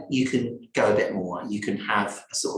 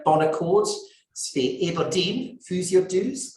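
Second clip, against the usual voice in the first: put-on light Aberdonian accent, a bit higher; 6 semitones higher than usual. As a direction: put on a playful Aberdeen accent for the tonic's name. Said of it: "That's my Aberdonian accent".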